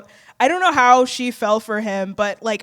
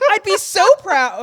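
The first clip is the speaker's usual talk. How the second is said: put-on high, loud proud old lady voice; quoting Edith; 8 semitones higher, 6 dB louder than usual. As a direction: gush it like a doting aunt. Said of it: "And then she says".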